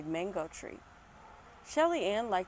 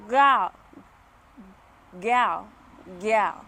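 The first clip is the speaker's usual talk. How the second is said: loud Jamaican granny calling; Jamaican accent; 9 dB louder, much slower than usual. Acted. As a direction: shout gal in Jamaican accent. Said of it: "channel my Jamaican accent".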